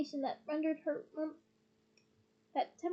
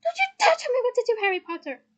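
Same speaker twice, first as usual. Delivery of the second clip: high, loud, rapid tirade; furious screech; 9 semitones higher, 10 dB louder, faster than usual. A narrator's reading. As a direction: screech a warning at a friend. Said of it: "she screeched".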